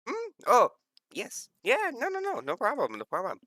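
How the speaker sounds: high, flustered string of assent; distracted, high-pitched agreement; dismissive scientist. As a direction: wave them off in a high, eccentric voice. Scientist hurriedly waves party toward computer.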